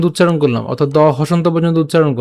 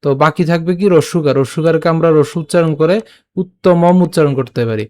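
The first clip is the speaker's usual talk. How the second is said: ordinary talk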